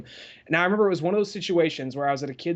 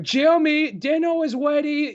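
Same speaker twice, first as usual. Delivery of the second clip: mocking parent's voice; 11 semitones higher, 5 dB louder than usual. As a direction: call the kid to dinner in a silly high voice. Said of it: "How do his parents talk?".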